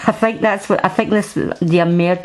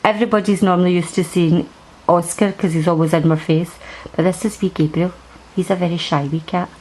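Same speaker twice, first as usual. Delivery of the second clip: ordinary talk, nothing marked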